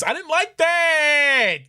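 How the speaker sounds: high, loud, slow panicked wail; panicked yelp. Real, not acted. Streamer spooked by Madoka, drawn-out high yelp. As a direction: stretch it out high and alarmed.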